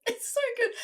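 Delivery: high, squeaky words through real laughter; breaking up completely, barely able to speak; helpless laughter. Real, not acted. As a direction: gasp out praise while cracking up, voice dropping to a whisper in places. Overcome with laughter at the scene.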